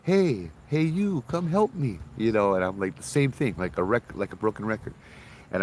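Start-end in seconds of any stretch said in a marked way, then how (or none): 0.0-2.0 put-on high childlike plea, slower at the end; little girl's voice. The same ghost child's voice repeating, high and pleading. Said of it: "we hear the same voice again".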